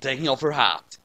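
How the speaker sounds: plain reading voice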